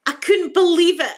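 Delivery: loud, high burst of real amazement; amazed disbelief. Real, not acted. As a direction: blurt it out, overwhelmed with happy disbelief. Reliving amazement at daughter walking.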